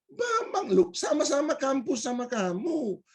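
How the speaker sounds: very high, incredulous insistence; mock exasperation. Stranger claiming the same campus, exasperated. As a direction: imitate an exasperated acquaintance pitched right up.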